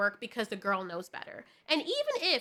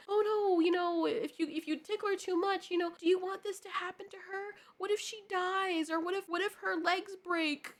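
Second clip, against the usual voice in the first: lightly voiced husband, higher and teasing; mock the husband's teasing warning, pitched up; 7 semitones higher than usual. Wife imitating her husband's teasing about the puppy. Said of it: "he'll say something like".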